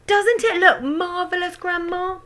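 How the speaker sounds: put-on high loud boy's voice; excited shout. A narrator's reading. George shouting up to Grandma. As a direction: call up proudly. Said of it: "George shouted".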